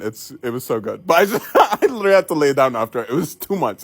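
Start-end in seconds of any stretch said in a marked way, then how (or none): 0.0-1.1 laughing. Real, not acted. Laugh on the line.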